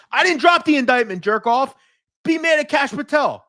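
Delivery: high and loud, defending himself; angry yelling. Real, not acted. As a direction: snap back hard at the heckler. Yelling at commenter Angelo.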